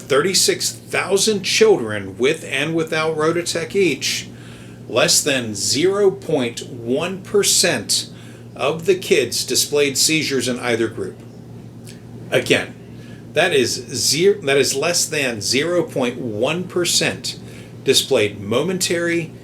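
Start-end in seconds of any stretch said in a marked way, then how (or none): 4.9-8.1 "zero point one percent" spaced out very slowly; emphatic figure. Real, not acted. Host stressing a tiny seizure rate, slow and emphatic. Stressing how small the risk is. 14.4-17.4 slow, deliberate weight on "zero point one percent"; emphatic repetition. Real, not acted. Host repeating the tiny rate for listeners, slow and emphatic.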